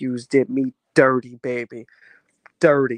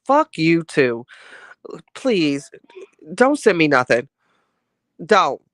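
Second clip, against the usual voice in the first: louder, firm don't, shutting it down; annoyed pushback; 3 semitones higher, 7 dB louder than usual. Real, not acted. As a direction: push the words back at the heckler. Snapping back at a rude comment.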